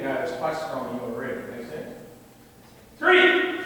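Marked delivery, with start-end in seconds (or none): 3.0-3.6 loud, high count shouted out; called drill count. Real, not acted. Coach counts punches for the class, loud high call. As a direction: bark the number to the class.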